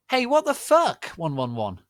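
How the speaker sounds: high, loud furious yell; caught-off-guard angry shout. Acted. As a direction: hurl it in outrage. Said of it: "Vampire shooted angrily".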